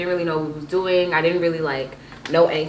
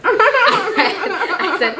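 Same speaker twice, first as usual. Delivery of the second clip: laughing; 14 semitones higher, 5 dB louder than usual. Real, not acted. Laughing while speaking.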